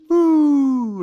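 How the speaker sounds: high, loud joyful whoop. Retold or imitated. Son speeding downhill to his mission, whooping loudly. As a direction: let out a thrill-ride whoop.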